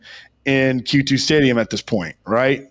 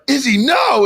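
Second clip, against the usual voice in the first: alarmed plea; 9 semitones higher than usual. Retold or imitated. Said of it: "And I'm like".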